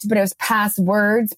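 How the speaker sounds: usual speaking voice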